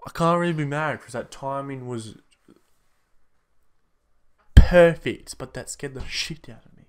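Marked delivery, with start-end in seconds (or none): 4.5-5.4 loud exclaim. Real, not acted. After jump scare.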